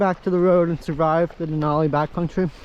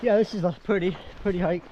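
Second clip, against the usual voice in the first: usual speaking voice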